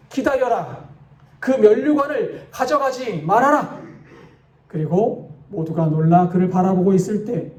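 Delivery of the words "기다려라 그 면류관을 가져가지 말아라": dramatized urgent call to halt. A narrator's reading. Soldier rushing forward to the departing angel, calling out. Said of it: "큰 소리로 외칩니다".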